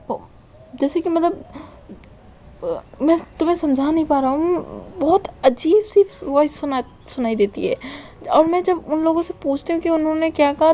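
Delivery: a hint of a confused friend struggling to explain; worried young man. Wing tells his friend about the strange voices, lightly worried.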